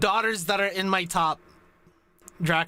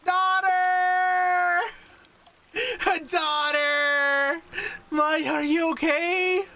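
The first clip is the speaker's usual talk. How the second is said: calling out in alarm; 8 semitones higher, much slower than usual. Acted. Sensei calls out to soaked student Maya, high and alarmed. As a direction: cry her name out like a frantic parent.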